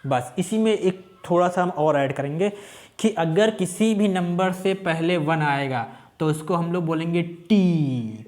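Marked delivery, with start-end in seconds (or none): 3.0-8.2 slow, drawn-out teacher statement with T; a planted mistake spoken slowly; deliberately wrong rule. Shown as a demonstration. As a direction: say it slowly, ending on "T" as if it were right. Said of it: "मैंने जानबूझ के गलत बोला है".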